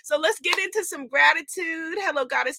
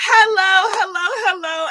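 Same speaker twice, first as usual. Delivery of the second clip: excited greeting; 7 semitones higher, 7 dB louder than usual. Real, not acted. Loud sing-song greeting.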